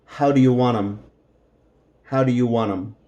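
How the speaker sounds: "want 'em" blended and quick; relaxed "How do you want 'em" as in speech; natural reduced "want 'em"; casual spoken form. Shown as a demonstration. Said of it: "This is how we will say it".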